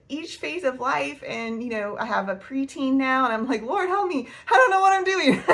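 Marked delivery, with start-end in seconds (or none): 3.6-5.5 high, loud, laughing cry for help; comic panicked prayer, close to laughing; mock-desperate plea. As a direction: plead in hammed-up helplessness. Acts out her desperate prayer.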